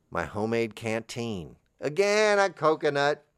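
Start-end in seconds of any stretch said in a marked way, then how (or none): 1.8-3.2 sudden loud again. Real, not acted. Sudden outburst.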